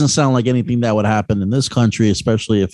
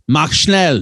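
German quote; 4 semitones higher than usual. Retold or imitated. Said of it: "So he probably said".

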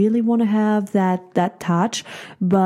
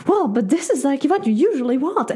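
mock defensive reply; 6 semitones higher, faster than usual. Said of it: "You're like".

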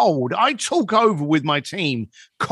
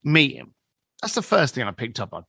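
ordinary talk

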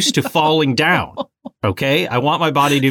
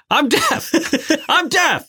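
put-on much higher whiny baby voice; big baby mode; slower than usual. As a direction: whine it like a panicked toddler. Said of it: "I went into big baby mode".

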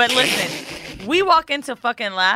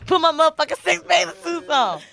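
slightly raised, louder mocking friend voice; a lighter hint of her squeaky Mandy; impersonation of Mandy; 5 semitones higher, 3 dB louder than usual. Co-host quoting Mandy's comeback in a lightly raised mocking voice. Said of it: "that was not a good impersonation".

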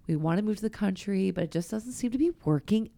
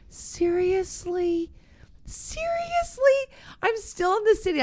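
drawn-out high squeal of disbelief, climbing higher; mock exasperated whine; 12 semitones higher, much slower than usual. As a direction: stretch it out high in playful protest. Mock complaint to God about staying.

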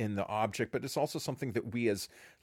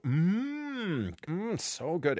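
high, exaggerated mm of delight; coaxing parent feeding baby; 7 semitones higher, much slower than usual. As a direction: hum like the food is delicious. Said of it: "you take a spoonful yourself and you go".

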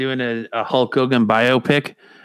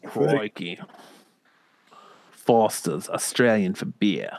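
soft, lightly put-on Australian; understated mock Australian slogan; Australian accent; 4 dB quieter than usual. Chiming in with stock catchphrases, light Australian accent.

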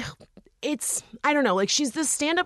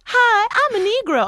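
mock chirpy greeting; 12 semitones higher than usual. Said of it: "And she's like".

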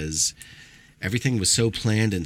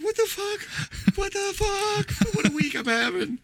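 much higher, slow, squeaky put-on voice; eye-patch guy mourning his monkey, high mock whine; mock grieving guy; slower than usual. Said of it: "He's like".

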